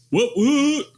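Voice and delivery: high, loud whoop; cheering about skipping the fee, excited. Real, not acted. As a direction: celebrate like a party horn. Celebrating free entry.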